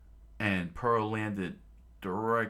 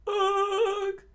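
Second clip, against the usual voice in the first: pained grunt; 8 dB louder than usual. Acted. Sanji struck, coughs blood.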